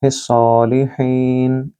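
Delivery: हिस्सालिहीन with a throaty ही, slowly. Shown as a demonstration. Teacher repeating the ending, slow throaty h. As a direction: stretch the ही. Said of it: "ही को गले से बोलिए".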